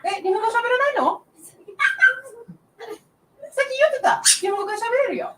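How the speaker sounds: quick, high teasing Japanese. Real, not acted. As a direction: rattle it off playfully in Japanese. Switches to Japanese teasing child.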